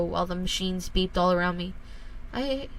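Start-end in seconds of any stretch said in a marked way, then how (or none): 2.3-2.7 high, tight voice on the edge of tears; a boy confessing to his father, voice close to breaking; holding back tears. A narrator's reading. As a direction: fight the tears as the words come out. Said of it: "trying to fight back the tears".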